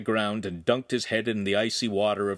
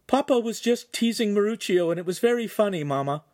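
put-on young girl voice; 11 semitones higher than usual. Girl tells mother.

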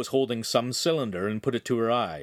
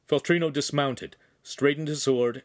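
ordinary delivery, nothing marked

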